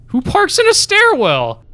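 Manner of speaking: high, slow, disbelieving question; baffled outrage at a car parked in a stairwell; incredulous. Real, not acted. Player baffled by a car in the stairwell, incredulous.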